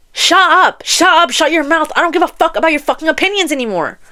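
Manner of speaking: high-pitched, heated silencing; angry telling-off. Acted. Telling a mean book man off, angry. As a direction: cut him off high and angry, then rattle on.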